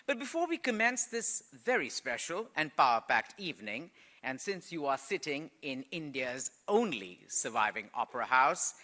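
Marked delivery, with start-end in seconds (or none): none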